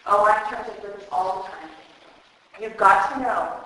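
normal talking voice

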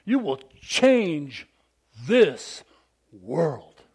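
slow, each word weighted; emphatic blessing. Acted. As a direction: lay every word down like a promise. Quoting the blessing to crowd.

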